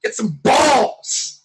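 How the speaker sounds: loud, slow and drawn out; slow, loud goading; agitating shout. Real, not acted. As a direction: bark it like a challenge to toughen up. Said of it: "you gotta have that agitating voice".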